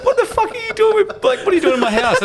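outraged at an intruder in his house; shocked yell. Retold or imitated. Finds mate in house at dawn.

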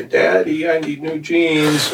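daughter voice. Retold or imitated. Voices daughter asking for jeans.

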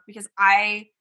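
usual speaking voice

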